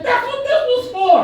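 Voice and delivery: high and loud, laughing through it. Real, not acted. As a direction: crack up delivering the punchline.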